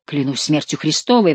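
ordinary delivery

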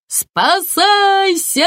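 high, loud, drawn-out cry; shouted warning. A narrator's reading. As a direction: yell to flee for your life. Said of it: "крикнул Кок". (громкий крик)

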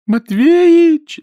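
высокий рыдающий крик; голосит сквозь слёзы, тонко и громко; плач. A narrator's reading. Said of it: "заливалась она слезами". (рыдающий зов)

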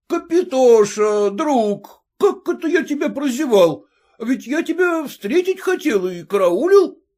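выше, медленный радостный возглас; обрадованный приятель; радостный учитель. Acted. Учитель радостно встречает друга. (учитель радостно встречает друга)

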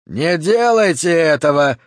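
yelling. A narrator's reading. Police chief yells to stop the prisoner's release, loud. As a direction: bellow it in desperation. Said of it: "заорал Хорлокер".

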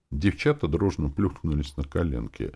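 plain reading voice

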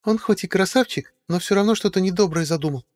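high, affected drawling diva; drag queen. A narrator's reading. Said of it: "жеманным голосом протянула Василиса".

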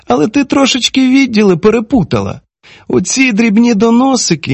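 high-pitched woman, measured reproof; lightly voiced female character, controlled; woman speaking confidentially. A narrator's reading. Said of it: "і тихо сказала".

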